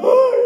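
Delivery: high, loud wailed "ay"; exaggerated crybaby wail; mock crying wail. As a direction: burst into a pretend cry. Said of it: "estás llorando".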